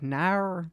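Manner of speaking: "Nar" said as a long, drawn-out Australian no. Retold or imitated. Imitating the Australian mom saying no, a drawn-out "Nar". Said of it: "no, but with the R".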